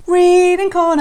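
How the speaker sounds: high sing-song segment announcement; jingle announcer. Acted.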